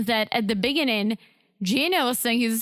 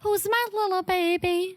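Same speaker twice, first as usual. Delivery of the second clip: imitated high, cooing baby-talk voice; 8 semitones higher than usual. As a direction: mimic someone cooing to their baby, pitched up high. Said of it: "we do this baby talk".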